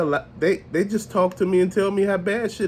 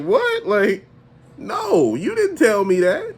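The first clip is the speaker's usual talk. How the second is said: high, incredulous squeak of a seller playing dumb; mock surprise; 7 semitones higher, 3 dB louder, slower than usual. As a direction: act shocked and innocent, pitch way up. Seller feigning surprise, retold.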